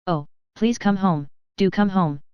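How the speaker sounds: ordinary delivery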